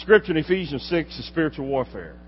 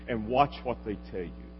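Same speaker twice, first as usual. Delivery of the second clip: ordinary talk, nothing marked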